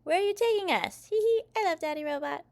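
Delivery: put-on high, loud, squealing kid; giggly excited child. A narrator's reading. Kids scooped up by Daddy Robot, delighted high voice.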